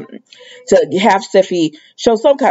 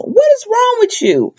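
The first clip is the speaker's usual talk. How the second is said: outraged disbelief. Real, not acted. Outraged at Sheila.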